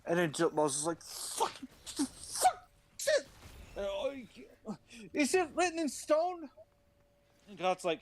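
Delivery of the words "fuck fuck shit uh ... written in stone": high, strained panic squeal; panicked squeal. Acted. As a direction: squeak it out in sudden horror. Moses panics, voice jumps high.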